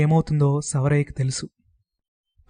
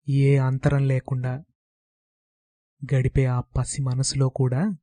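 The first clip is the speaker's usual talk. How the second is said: usual speaking voice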